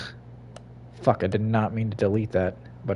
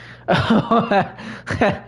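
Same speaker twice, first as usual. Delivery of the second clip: high, breathy burst of laughter; 10 semitones higher than usual. Real, not acted. Laughs at game character.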